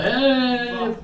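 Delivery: high loud indignant "Hey!"; mock protest. Audience member reacting to the joke, indignant shout. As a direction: bark it like a guy caught out. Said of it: "he's already like".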